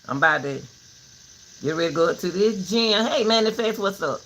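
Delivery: ordinary talk, nothing marked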